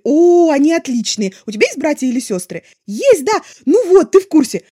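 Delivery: loud, high, bubbling with plans; eager chatterbox. A narrator's reading. Wei Ying pitches fun plans to Lanzhan, loud and eager. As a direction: pour out every idea at once.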